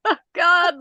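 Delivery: high, loud mock-weepy outburst; tearful, overwhelmed. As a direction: act out being moved to tears by kindness. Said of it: "I'm, like, so freaking emotional".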